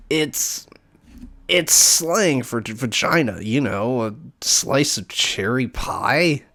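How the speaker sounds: put-on high, loud snooty voice with a dopey childish edge; snooty dopey girl. Heather brushing off the pie joke, high snooty whine. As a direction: play her prissy but dim.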